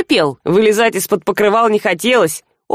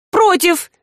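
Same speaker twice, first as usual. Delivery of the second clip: indignant; 8 semitones higher than usual. A narrator's reading. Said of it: "тут же возмутилась Рыска".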